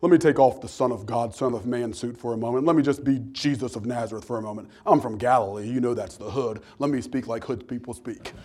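low, soft-spoken aside in character; Jesus. Pretend. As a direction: quietly step out of the holy role. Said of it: "What if Jesus would have like".

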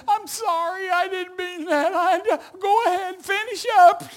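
high-pitched sheepish pleading; hammed-up apologetic fluster; mock flustered apology. Apologizing to glaring player, acted.

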